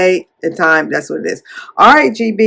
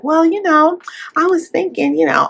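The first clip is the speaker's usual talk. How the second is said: put-on higher, hesitant girlish voice; a lightly voiced, higher coy friend; 9 semitones higher than usual. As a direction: play the friend a bit coy and high. Friend's coy reply retold.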